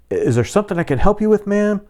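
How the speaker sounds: high, quick, officious cop voice; polite cop. Retold or imitated. Cop to the woman outside her car, high and quick. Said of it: "the guy's like".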